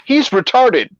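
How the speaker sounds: high, earnest mock-innocent voice; innocent explanation. Friend fooling the cop, high innocent voice.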